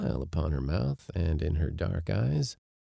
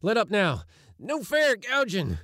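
high, protesting cry; calling out; 14 semitones higher than usual. A narrator's reading. Givens under the lion, calling out. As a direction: call out in a much higher voice. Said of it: "He remembered calling out".

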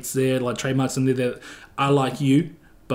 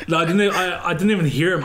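normal talking voice

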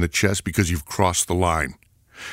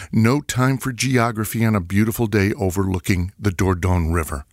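usual speaking voice

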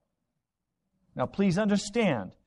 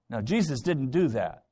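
normal talking voice

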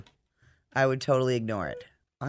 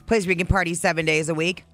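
upbeat radio ad read; lively sponsor read on air; 6 semitones higher than usual. Acted. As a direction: sell the club like a live radio spot. Host reads sponsor ad.

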